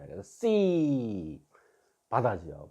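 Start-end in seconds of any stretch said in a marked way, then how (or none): 0.2-1.4 "씨" drawn out slowly on a long falling ee; one stretched see on "씨"; long vowel demo. Shown as a demonstration. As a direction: hold the vowel of "씨" as a model for learners. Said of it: "그리고 이게 이 소리야".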